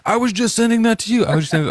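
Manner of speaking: excited. Retold or imitated. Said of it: "the next one's like".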